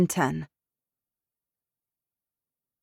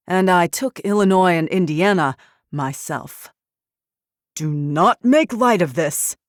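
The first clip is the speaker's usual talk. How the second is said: loud, hard furious tirade; 6 dB louder than usual. Acted. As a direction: rage down the phone at him. General furious on phone.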